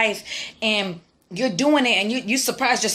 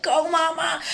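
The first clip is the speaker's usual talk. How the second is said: high cheerleader call from the sideline; cheering; 8 semitones higher, slower than usual. Retold or imitated. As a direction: cheer her on, pitched up. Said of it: "I'm her biggest cheerleader on the sideline".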